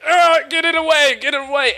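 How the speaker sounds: high, loud hammed-up panic; mock panicked cry. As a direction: act out a man falling from a wheelchair. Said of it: "he's like".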